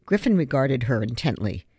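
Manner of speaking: plain reading voice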